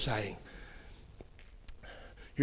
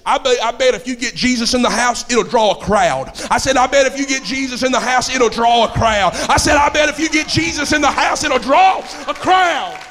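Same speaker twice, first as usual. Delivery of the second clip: repeated line building to a high shout; rousing pulpit refrain, rising in pitch and force; full-force preaching; 6 semitones higher, 9 dB louder than usual. Acted. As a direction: drive it home louder each time.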